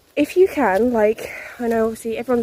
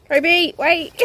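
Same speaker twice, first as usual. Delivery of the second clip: calling out; 8 semitones higher, 5 dB louder than usual. Real, not acted. Calling the dog.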